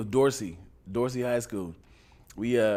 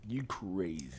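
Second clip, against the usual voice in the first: muted disbelief; 10 semitones lower, 14 dB quieter than usual. Retold or imitated. Low soft punchline to quote.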